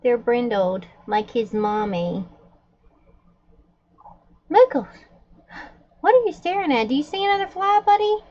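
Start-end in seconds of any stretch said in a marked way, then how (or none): 4.5-8.2 high, fond question to the dog; pet talk. Real, not acted. Owner asking her dog Muggles what it is staring at, in a high fond voice. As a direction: ask the dog sweetly what it sees.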